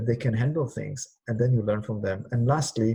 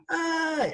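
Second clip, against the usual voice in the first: a much higher, crying "aye" of pain; 4 dB quieter than usual. Shown as a demonstration. Showing twenty hosts how to cry out pain. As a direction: let the hurt out in one cry. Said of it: "Please stand up and do".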